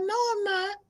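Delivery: high protesting denial. Retold or imitated. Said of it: "She was like".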